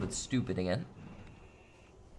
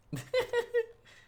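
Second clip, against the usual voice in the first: 1 shrill laugh; 3 dB louder than usual. Acted. Evil laugh.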